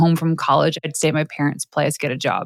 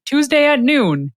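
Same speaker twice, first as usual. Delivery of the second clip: grudging reply; 9 semitones higher than usual. Retold or imitated. Answering husband, reluctant reply.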